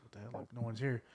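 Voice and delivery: quiet, understated retold bewilderment; freaked-out aunt, hushed. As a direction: murmur her puzzled reaction softly. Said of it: "she's freaked out about it".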